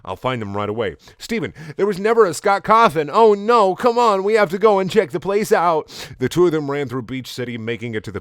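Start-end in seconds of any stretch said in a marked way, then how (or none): 1.2-5.9 very high, loud cartoon panic; shrieky hammed-up alarm; Connie in alarm. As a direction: cry out urgently in a pitched-up voice.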